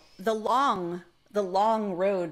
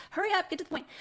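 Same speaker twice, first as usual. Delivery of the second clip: high, impatient hurry-up; 12 semitones higher, much faster than usual. Retold or imitated. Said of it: "I think it's just too".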